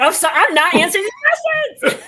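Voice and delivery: high, loud, laughing through the words; laughing at herself. Real, not acted. Guest on a podcast admitting she's rambling, laughing out loud. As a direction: giggle out the self-mocking admission.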